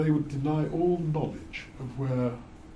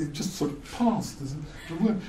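ordinary talk

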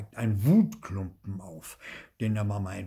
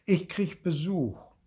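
put-on child's brush-off; 7 semitones higher than usual. A narrator's reading. Nico puts off his friend.

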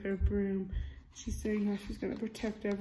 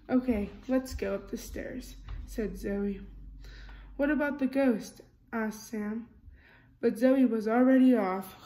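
lively storybook reading, character lines a bit louder; reading to children; 5 dB louder than usual. A narrator's reading. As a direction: read it like a bedtime picture book. Reading picture book aloud.

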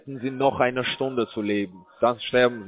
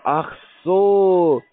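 überrascht; 7 semitones higher, 5 dB louder than usual. Real, not acted. Sudden realisation.

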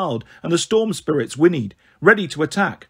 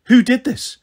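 higher, mildly raised yell; narrated yell, high rather than very loud; yelled question; 7 semitones higher, 3 dB louder than usual. A narrator's reading. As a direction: yell it with restraint, pitched well up. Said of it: "Jason yelled".

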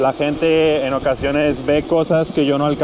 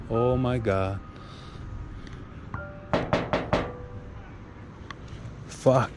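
hushed, nervous exclamations; nervous night vlog whisper; 11 dB quieter, much slower than usual. Real, not acted. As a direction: softly swear under your breath at the eerie door. Night exploration, reaching eerie door.

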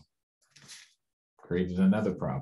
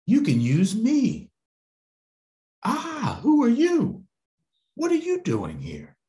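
high, louder startled squeak; startled child; 5 dB louder than usual. Acted. Little girl startled by a voice in the dark, high and loud. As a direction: jump at a sudden stranger.

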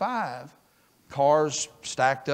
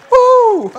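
joyful woo; 13 dB louder than usual. Real, not acted. Celebrating money from God.

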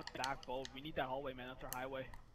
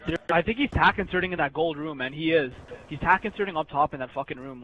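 loud, urgent shouting; shouted callout; 11 dB louder than usual. Real, not acted. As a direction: bark the enemy position. Heated callout in match.

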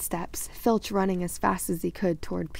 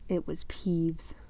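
whispered narration; 3 dB quieter, slower than usual. A narrator's reading. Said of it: "whispered narration".

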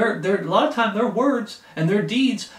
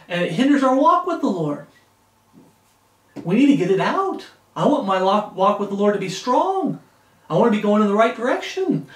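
louder, building pulpit fervour; preacher stirring the congregation, louder; rising preaching; 3 semitones higher, 4 dB louder than usual. Acted. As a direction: drive it home from the pulpit.

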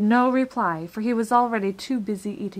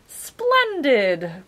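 loud, higher exclamation; delighted cry; 5 semitones higher, 7 dB louder than usual. A narrator's reading. Dodecahedron praising Milo's answer, loud delighted cry. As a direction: cry out your hearty approval. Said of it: "cried the dodecahedron".

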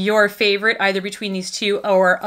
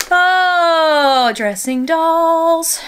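higher, louder, drawn-out sing-song; gushing delight; 8 semitones higher, 5 dB louder, much slower than usual. Real, not acted. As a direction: savour the joy of it.

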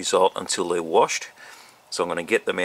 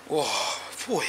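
shocked by sourness; 3 semitones higher, 7 dB quieter than usual. Real, not acted. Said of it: "eyes are watering".

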